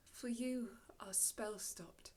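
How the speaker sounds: sudden near-whisper after a command; hushed spell. Acted. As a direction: drop to a soft, ominous hush. Prospero's spell holds them still.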